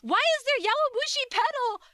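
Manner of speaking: high, loud, disbelieving; shocked disbelief, voice shooting up. Real, not acted. Shocked by figurine still selling.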